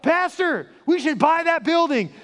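high, loud call out to the pastor, slowing on the last words; eager shout from the back. Retold or imitated. Congregant shouting to the pastor from the back row, loud and eager. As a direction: jump up and call it out across the room.

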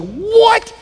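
very high, explosive cry; hammed-up furious disbelief; enraged shout. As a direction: erupt in one high shouted word. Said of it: "He's raged".

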